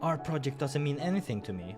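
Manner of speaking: plain reading voice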